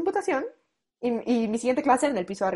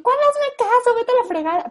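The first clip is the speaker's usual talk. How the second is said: much higher, louder angry snap; furious retort; 13 semitones higher, 4 dB louder than usual. Retold or imitated. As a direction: spit it back at him. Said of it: "Pero así, horrible". (réplica furiosa, mucho más aguda y fuerte)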